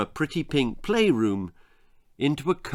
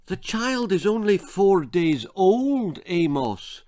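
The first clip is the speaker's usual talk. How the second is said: higher, louder Betty objecting; exasperated wife; 8 semitones higher, 3 dB louder than usual. A narrator's reading. Betty protests to Amos about the newborn. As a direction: remind him, appalled. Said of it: "said Betty".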